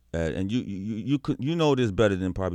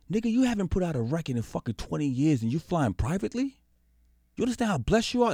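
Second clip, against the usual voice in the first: high-pitched, incredulous telling-off; imitating scolding friend; 10 semitones higher than usual. Friend scolds him for complaining while flying privately, incredulous high-pitched rebuke. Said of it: "He said".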